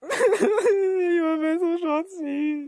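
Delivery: very high, slow, laughing fake sob; mock sobbing whine. Gamer repeating the opponent's move as a fake sob. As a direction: drag it out like a crybaby through laughter.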